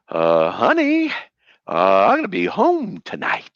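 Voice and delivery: very slow, hesitant, with stretched-out words; deliberately unconvincing. Shown as a demonstration. Husband testing a lie on his wife, haltingly unconvincing. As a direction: stall on each "uh" and drag it out. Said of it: "can I say it without intending to deceive her".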